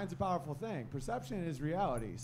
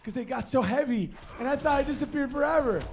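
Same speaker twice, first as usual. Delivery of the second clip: high, loud, building shouts of dog joy; overjoyed dog shouting; 11 semitones higher, 9 dB louder than usual. Retold or imitated. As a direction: shout like a dog back from the dead. Dog voiced in joke.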